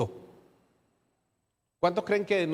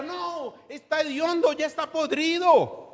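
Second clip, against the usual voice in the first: Martha voiced; 10 semitones higher than usual. Martha objecting to opening the tomb.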